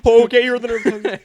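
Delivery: high, loud and fast through laughter; mock reaction. Adult plays along with fleeing kid, loud laughing reply. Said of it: "And you're like".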